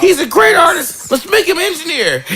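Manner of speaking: high, pushy immigrant mom; mother's voice. Retold or imitated. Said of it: "Love the voice".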